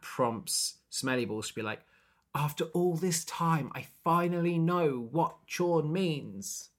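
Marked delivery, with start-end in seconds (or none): 2.3-6.7 high, drawn-out mock revelation; hammed-up, slow pronouncement; momentous reveal. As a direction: stretch it out like a long-awaited discovery. Acted slow-speaking character's big reveal.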